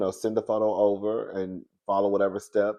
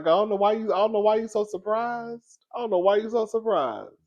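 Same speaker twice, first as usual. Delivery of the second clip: knowing scoff; 10 semitones higher than usual. Retold or imitated. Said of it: "I'm like".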